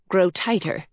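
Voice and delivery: ordinary delivery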